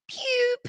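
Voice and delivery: high squeaky "Pew!"; a tiny high zap, mocking; mock whistle noise. Mocking Messi going down.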